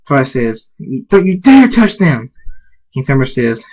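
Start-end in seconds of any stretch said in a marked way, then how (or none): 1.1-2.3 higher, louder protective outburst; indignant, raised voice; angry warning. Acted. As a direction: warn the villain off sharply. Heroine defends family from villain.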